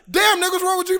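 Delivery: retold aggressive text. Replaying the girl's text to his bro, mock aggressive. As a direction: imitate a high, affronted outburst. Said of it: "that's pretty aggressive".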